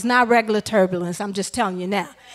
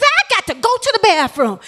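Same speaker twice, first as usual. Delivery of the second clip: high, urgent imitated voice of a passenger; lady on the plane begging the stewardess, high mock urgency; imitating the lady; 9 semitones higher than usual. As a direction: play the desperate lady. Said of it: "She said".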